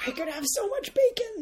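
high, thrilled gasp of delight; excited squeal. Acted. Greedy character squealing about all that bacon.

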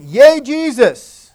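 stretched-out playful cheer; mock cheer. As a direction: cheer it out like the kids. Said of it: "So they were saying".

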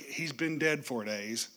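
slow, pointed jab at Jesus; passive-aggressive sister jabbing; little jab. Retold or imitated. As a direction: needle him with the four days. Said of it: "one more little jab".

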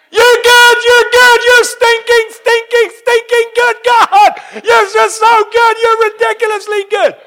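high, full-voiced ecstatic screaming; screaming praise. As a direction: scream it out in overwhelmed disbelief, acting out the moment. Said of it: "I was screaming".

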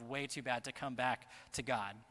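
ordinary talk

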